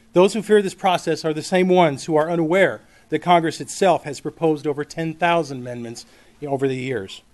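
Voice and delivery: usual speaking voice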